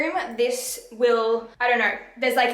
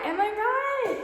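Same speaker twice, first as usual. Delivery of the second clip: higher playful repeat of the question; sing-song echo; 10 semitones higher, slower than usual. Real, not acted. Vlogger teasing viewers about the aesthetic, sing-song high.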